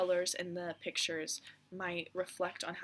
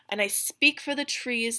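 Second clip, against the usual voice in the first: stronger and louder, pushing the words out; louder repeat; 3 semitones higher, 9 dB louder than usual. Acted. The Lorax repeats his claim to the Once-ler, louder and firm. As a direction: proclaim it firmly this time.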